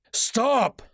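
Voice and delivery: shouted command. Acted. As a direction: break up the fight with one word. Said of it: "the newcomer shouted".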